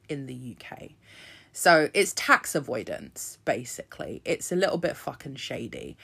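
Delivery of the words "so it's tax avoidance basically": usual speaking voice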